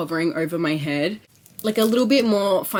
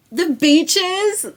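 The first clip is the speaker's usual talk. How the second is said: high, louder mock-surprised question; delighted echo; 10 semitones higher, 6 dB louder than usual. Real, not acted. Vlogger repeating her trip list to viewers, delighted high squeal. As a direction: squeal the word like it's a treat.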